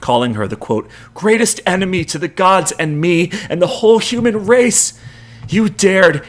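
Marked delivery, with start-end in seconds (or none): 1.1-4.9 high-pitched bitter accusation; angry shout. A narrator's reading. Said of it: "Jason shouts up at her". 5.5-6.2 high, loud outraged cry; furious. A narrator's reading. Jason's furious accusation.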